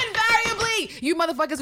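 high, loud shout on the word; emphatic shout. Real, not acted. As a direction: fling the word out. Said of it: "shouting at the audience".

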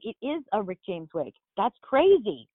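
ordinary delivery

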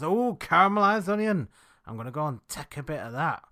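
high, loud mock Yorkshire delight, then settling; fake Yorkshire accent. Dog walker tempted by caramelised onion, mock Yorkshire accent. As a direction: exclaim like an impressed Yorkshire local. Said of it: "your fake Yorkshire accent".

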